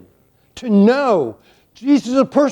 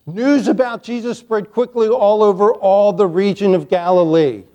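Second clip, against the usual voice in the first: ordinary talk, nothing marked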